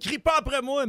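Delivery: sharp high mock yell at the child; yelling back. Parent yelling at a shouting child, mock yell. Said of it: "ton enfant va te répondre en criant".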